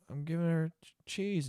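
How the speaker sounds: put-on soft, halting answer of a cornered child; meek kid. Kid answering his mom about the cheese, meek. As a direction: quietly stumble through an innocent explanation.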